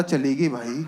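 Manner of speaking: mock sob. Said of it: "कह रहा".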